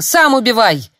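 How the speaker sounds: loud, high, desperate shout; breaking scream. A narrator's reading. Girl refuses to kill, throws it at mentor, screaming. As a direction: hurl it back at him, losing control. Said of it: "срываясь, закричала девушка".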